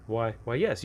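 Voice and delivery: ordinary talk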